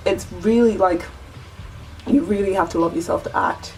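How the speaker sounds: ordinary delivery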